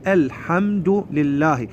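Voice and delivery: الحمد لله slowly, with the L of ال sounded; slow teaching model of الحمد لله; clear lam. Shown as a demonstration. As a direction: spell out each syllable of الحمد لله. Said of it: "هذا ال-، اللام يظهر، نقول".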